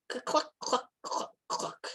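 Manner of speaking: soft, breathy clucks; hen clucking. A narrator's reading. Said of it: "all she managed was cluck".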